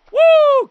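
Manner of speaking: high, loud woo; elated high cheer; victory whoop. Real, not acted. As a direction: whoop it out in celebration. Celebrates walk-off win.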